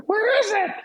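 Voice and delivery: high frantic mock yell; imitating a panicked brother; retold yell. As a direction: shout it high from the doorway. Brother yelling from the door.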